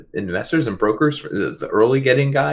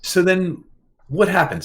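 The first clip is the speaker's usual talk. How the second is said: ordinary delivery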